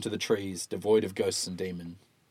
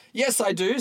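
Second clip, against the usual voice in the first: character voice; 12 semitones higher, 4 dB louder than usual. Acted. Walton argues back.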